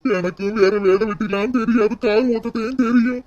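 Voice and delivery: acted crying, voice climbing as despair builds; tearful lament. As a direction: whimper helplessly to your wife, fearful and crying. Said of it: "அழுகாதீங்க".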